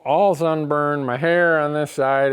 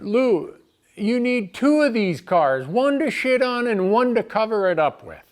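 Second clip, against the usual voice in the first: loud, higher scornful jab; heated insult; 9 semitones higher, 4 dB louder than usual. Retold or imitated. Narrator mocks Lou's car to his face, loud and scornful. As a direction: sneer at the car.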